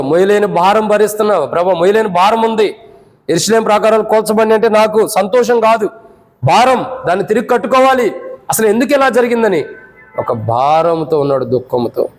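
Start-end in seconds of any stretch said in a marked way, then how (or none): 0.0-9.6 high, impassioned cry of a burdened heart; burdened plea. Acted. Nehemiah pouring out his burden to the Lord, burdened plea. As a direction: plead urgently, voice pitched well up.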